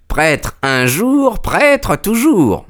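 voix très aiguë et traînante; vieux prêtre véhément. Acted. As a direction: proclamer lentement, haut perché. (protestation sentencieuse et aiguë)

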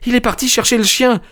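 put-on very high young woman's voice; a touch of alarm, pitched right up; frightened stammer, lightly played. A narrator's reading. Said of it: "balbutia mademoiselle Hunter". (voix aiguë, légèrement affolée)

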